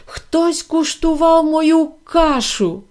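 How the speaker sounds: a hint of a roaring papa bear, drawn out; loud scary roar. A narrator's reading. Big bear finds his porridge eaten, mildly roaring. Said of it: "зареве своїм гучним, страшним голосом".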